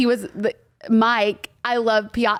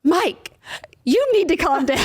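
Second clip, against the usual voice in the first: mock scolding; 7 semitones higher than usual. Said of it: "And I was like".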